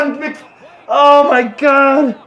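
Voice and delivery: stunned by a blunder on screen; high, stretched disbelieving cry; shocked cry. Real, not acted. Dismay at a mistake.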